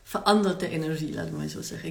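ordinary delivery

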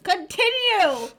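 loud, slow, drawn-out answer; emphatic shout. Real, not acted. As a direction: belt out the answer slowly. Repeats the answer loudly.

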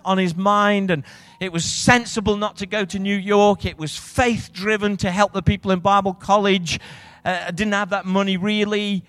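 rally the room to answer; preaching at full force. Acted. Pastor rousing the church to expect provision, full preaching force.

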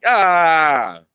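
sudden loud scared yell; a loud scream of fright; startled scream. Retold or imitated. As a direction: yelp in fright at the shark. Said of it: "And I'm like".

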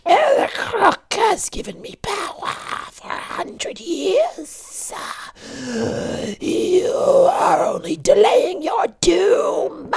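a ghostly demon taunting from above; eerie high whispering monster. A narrator's reading. Villain Akasu speaks.

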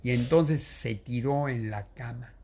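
ordinary delivery

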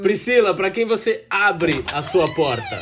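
announcer voice. Acted. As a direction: announce the segment like a show host. Host asks segment question.

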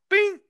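ping it out like a machine beep; imitated chime. Caller mimics his dishwasher's opening beep, high bright bing. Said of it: "It goes".

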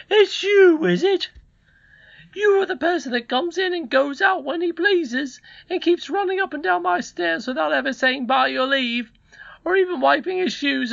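very high, loud, indignant scold; scolding housekeeper. Acted. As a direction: berate the boy in a high-pitched character voice.

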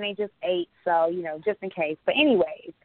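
ordinary delivery

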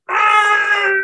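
a high monster screech; the GM voices the monster's shriek for the players, a shriek; Godzilla shriek. Acted. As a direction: let out a giant monster's shriek. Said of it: "just Godzilla-like shriek".

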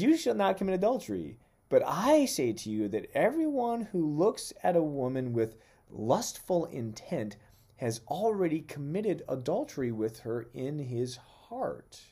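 loud, high, emphatic proclamation; forceful scripture reading. Acted. Preacher proclaiming the law on adultery, loud and emphatic. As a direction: proclaim the commandment with full force.

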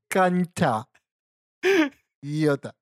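"Cancha" said with the tongue out; tongue sticking out. Shown as a demonstration. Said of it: "con la lengua afuera". ("Cancha" con la lengua afuera)

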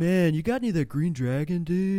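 hippie stoner asking for weed. Retold or imitated.

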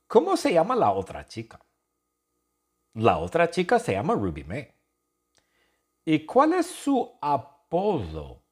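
ordinary delivery

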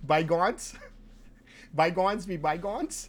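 high, questioning "by-GONZ"; odd pronunciation of bygones. Shown as a demonstration. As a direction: insist on "Bygones" as "by-gonz", voice rising. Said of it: "I don't think that's how you say it".

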